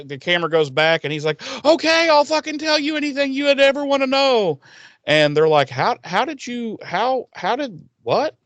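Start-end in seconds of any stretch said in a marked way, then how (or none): 1.6-4.6 high squeaky voice, instantly giving in; a broken minion blurting it all out; caving minion. Retold or imitated. Minion caving to Giles after the torture, squeaky high. Captured minion suddenly talks. 5.7-8.3 high stammering disbelief, slowing down; can't even finish the question; stunned stammer. Retold or imitated. The gang asking Giles how he did it, stunned and high.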